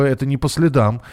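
normal talking voice

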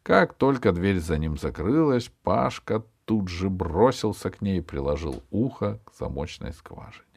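usual speaking voice

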